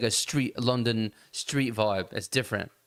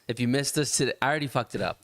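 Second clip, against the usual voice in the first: ordinary talk, nothing marked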